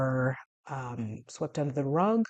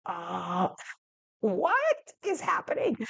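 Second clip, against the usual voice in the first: high, breaking disbelief; baffled cry; 4 semitones higher than usual. Retold or imitated. Telling brother about the doctor, high dismayed squeak. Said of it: "I was like".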